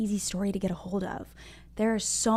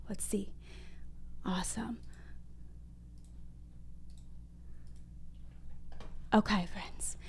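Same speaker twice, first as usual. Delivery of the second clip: soft whisper; 3 semitones lower, 16 dB quieter than usual. Real, not acted. Quiet cue to the children.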